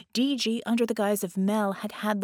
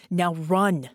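urgent command; 6 dB louder than usual. Acted. Genie urges Vicky to flee.

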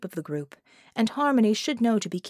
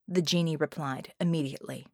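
ordinary delivery, nothing marked

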